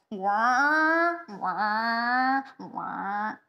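drawn-out mock truck wail; truck impersonation. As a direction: imitate a big truck roaring past, loud then fading. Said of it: "best truck impersonation".

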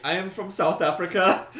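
high, loud mock South African accent; hammed-up South African scammer accent; bad South African accent. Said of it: "it was a really bad accent".

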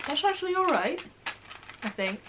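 speaking between bites; talking while chewing. Real, not acted. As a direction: mouth partly full. Seen 2: Bites cracker at -4; jaw and lips still working while speaking.

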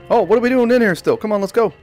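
loud, high and hurried; impatient urging. Real, not acted. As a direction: hurry them out, exasperated. Urging the character to hurry.

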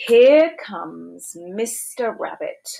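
ordinary delivery

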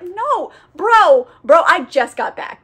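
mock protest, high start and loud middle; exasperated refusal. Tired vampire refusing to share his blood, exasperated. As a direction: push back loudly, coming down in pitch. Lestat refuses Armand, acted.

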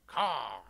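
a loud mock crow caw; shouted bird call. Imitating a bird at a bird.

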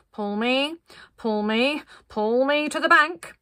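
measured call, rising in steps; flat-voiced call. A narrator's reading. Linda calling to be pulled ashore, flat voice. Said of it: "in her Linda flat voice called".

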